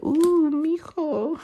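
high, slow flirty purr; slow high flirtatious coo; flirty ooh. Retold or imitated. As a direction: flirt with the tagger.

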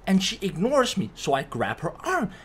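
normal talking voice

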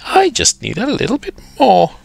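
put-on quick, high-pitched old lady; hurried little request in a high old-lady voice. A narrator's reading. Old lady asks for more tissue.

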